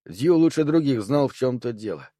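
ordinary delivery

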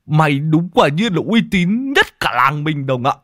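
high, mocking recital broken by chuckles; Môn Phọt. A narrator's reading. Friend mocks Đồng's downfall to his face, barely contained laughter. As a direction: tease him grandly while holding back laughter. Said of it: "Môn Phọt cố nhịn cười nhìn ông Đồng".

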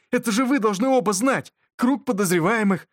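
insistent. A narrator's reading. Beska insists on answers.

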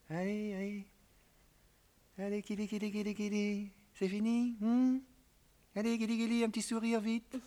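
put-on high cooing baby talk. Husband cooing baby talk to cheer up his crying wife. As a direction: coax a smile like tickling a child.

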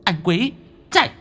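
high, desperate cry to run; panicked plea, rising high; frantic warning. Acted. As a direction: scream at him to get away. Ghost warns her lover to flee.

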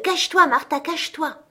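put-on high, eager child voice; urgent children. A narrator's reading. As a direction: hurry her to hide like a giddy kid. Children urging Martha to hide. (enfants pressés, voix aiguë)

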